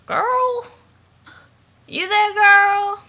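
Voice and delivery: slow, loud far-off call, high. Acted. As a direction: stretch it out as if calling across a distance. Calling out for the girl.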